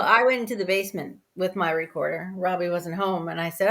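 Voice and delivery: ordinary talk, nothing marked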